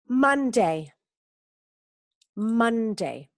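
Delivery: Monday said as "mande", falling; teacher modelling a word for the class; model pronunciation. Shown as a demonstration. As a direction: say Monday clearly for them to copy. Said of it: "Aquí la pronunciación es súper importante".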